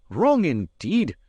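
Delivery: lightly voiced young lass; a hint of a Scots maid. A narrator's reading. Maid's voice in story.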